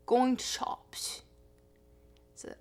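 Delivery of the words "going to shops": "going to shops" with a hard clipped T for "the"; Yorkshire "going to shops", the article shrunk to a T; the cut to T. Shown as a demonstration. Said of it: "the is often shortened to a hard t".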